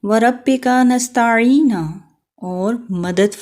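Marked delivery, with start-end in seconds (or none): 0.0-1.7 Quranic recitation. Shown as a demonstration. Teacher recites Quranic phrase. (قرآنی تلاوت کا انداز)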